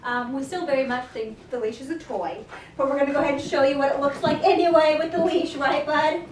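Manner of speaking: ordinary talk, nothing marked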